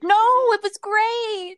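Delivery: high, loud, drawn-out mock wail; emphatic protest. Author insisting to a friend their first work was great, emphatic protest. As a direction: wail the objection, slow and high.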